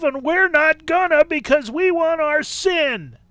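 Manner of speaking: loud, high-pitched hammed-up protest; mocking objectors. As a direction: mimic scornful unbelievers, building to a shout. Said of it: "That's pretty much what they say".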